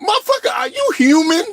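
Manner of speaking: high shout of disbelief, cracking with laughter; mock disbelief. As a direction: yell it like you can't believe your eyes. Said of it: "Looking at it, I'm like".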